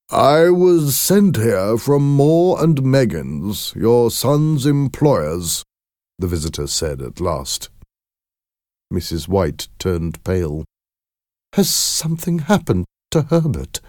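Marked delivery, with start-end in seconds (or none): 0.1-5.6 slow, high, drawn-out formal visitor; visitor bearing news. A narrator's reading. Company man announcing himself to the parents, slow and high-pitched. 11.5-13.8 high, anxious mother; nervous. A narrator's reading. Mother asking the visitor about her son, nervous. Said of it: "she asked nervously".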